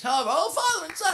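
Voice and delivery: high-pitched imitation of her gushing; mock gushing. Father mimicking the mother in the elevator, high mock gush.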